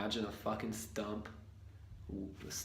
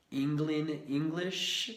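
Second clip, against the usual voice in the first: slow, hammed-up English accent, higher; 5 semitones higher, 4 dB louder, slower than usual. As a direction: drawl it like a mock Englishman. Mimicking English speakers.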